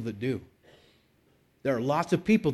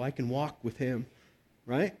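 ordinary talk

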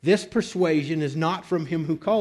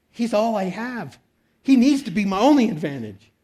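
higher, louder pleading preacher; impassioned preaching; 6 semitones higher, 4 dB louder than usual. Acted. Pastor pleading with the congregation, higher and urgent.

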